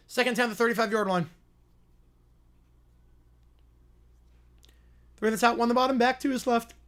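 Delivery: lively TV announcer calling the drive; play-by-play call. Acted. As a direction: call the game like a network broadcaster.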